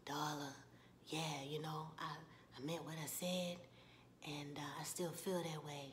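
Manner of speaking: low, soft, hesitant male character; Ralph Angel. Retold or imitated. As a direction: murmur a tender admission, voice dropped. Voices Ralph Angel in tender scene.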